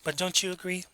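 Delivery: soft, coaxing tag question; politely angle for agreement; condescendingly polite. Shown as a demonstration. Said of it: "people who are very condescending".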